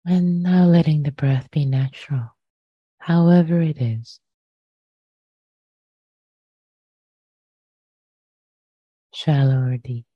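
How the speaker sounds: unhurried meditation guidance with long pauses between phrases; guided meditation. Real, not acted. Teacher inviting listeners to settle into the body, guided meditation. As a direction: lead them gently into the breath, leaving lots of silence.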